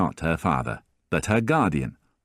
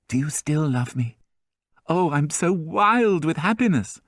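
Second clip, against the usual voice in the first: put-on a higher young girl's voice, overjoyed; breathless young Cosette; 8 semitones higher than usual. A narrator's reading. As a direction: gush to him, giddy with happiness.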